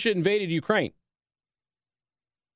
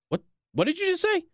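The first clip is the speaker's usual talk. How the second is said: much higher, incredulous put-on; mock disbelief; 11 semitones higher than usual. Host voices the general reacting to the reporter, incredulous. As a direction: sound baffled by the question.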